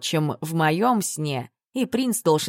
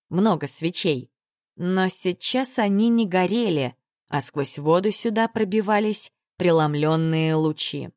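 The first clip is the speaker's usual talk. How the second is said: usual speaking voice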